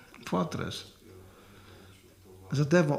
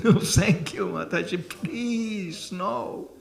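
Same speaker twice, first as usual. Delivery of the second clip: laughing plea; 6 semitones higher, slower than usual. Retold or imitated. Pleading refusal of more food.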